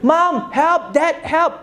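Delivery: put-on high-pitched child calling for help; frightened child. Child runs to parents' door at night, calling for help. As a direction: call out to your parents like a scared kid. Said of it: "We immediately tell them the subject".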